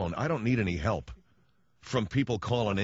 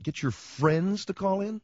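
higher, slowed, baffled question rising at the end; puzzled echo; 8 semitones higher, slower than usual. Retold or imitated. Woman repeating the caller's odd request, slow and baffled. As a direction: repeat it back slowly, not getting it.

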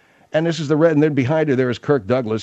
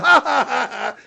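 higher mock guffaw; mock laughter; 10 semitones higher than usual. Host imitating his first reaction to the house, mock laughing. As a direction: burst into a put-on, higher laugh. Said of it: "I almost went".

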